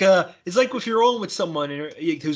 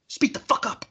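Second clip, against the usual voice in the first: exasperated demand; 8 semitones higher than usual. Retold or imitated. Imagined rebuke to quiet talkers.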